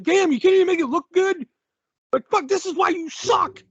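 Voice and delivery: high-pitched fed-up outburst; exasperated heckling. Retold or imitated. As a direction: heckle a useless wrestler in exasperation. Ranting at a bad wrestler.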